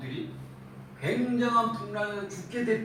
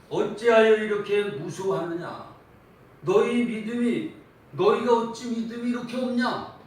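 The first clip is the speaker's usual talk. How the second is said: loud, higher stern scolding of the disciples; stern rebuke; 4 semitones higher, 6 dB louder than usual. Retold or imitated. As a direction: scold them like a disappointed master. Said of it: "이렇게 책망하시는 겁니다".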